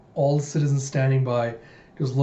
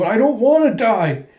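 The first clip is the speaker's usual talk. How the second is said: high, loud panicked yell; imitated screaming; 9 semitones higher, 7 dB louder than usual. Koyomi struggles in Den's grip, high screaming protest. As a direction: scream it in acted terror. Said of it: "she's screaming and struggling".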